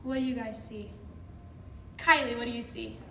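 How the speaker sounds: ordinary talk, nothing marked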